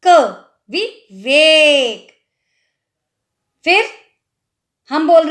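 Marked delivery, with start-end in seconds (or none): 0.1-2.2 विवेक stretched very slowly, short vi and ve; slow careful spelling. Shown as a demonstration. Teacher sounding out विवेक, very slow. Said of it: "वै नहीं बोल रही".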